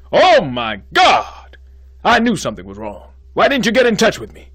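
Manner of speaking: loud, high, frantic exclamation; panicked outburst. Acted. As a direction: blurt out in panic. Dean panics at fainting news.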